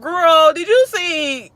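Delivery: high, loud excited call to her AI; excited exclaim. Retold or imitated. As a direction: squeal it to a friend. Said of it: "I said".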